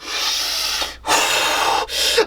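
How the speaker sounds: long voiceless breath out; long bracing breath. Retold or imitated. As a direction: compose yourself with one big breath. Composing himself before declaration.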